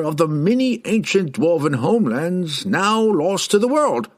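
put-on high-pitched, deliberate old scholar; old herald explaining. A narrator's reading.

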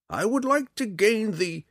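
very high character voice, left hanging; old man, word-searching. Acted. Old man asks the drow along, high and searching. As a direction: pitch it up and stop short for a word.

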